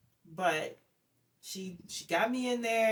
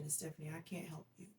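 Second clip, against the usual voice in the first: much softer retold doctor; retold bad news; 2 semitones lower, 12 dB quieter, faster than usual. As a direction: quote the doctor very quietly. Doctor gently delivering bad news.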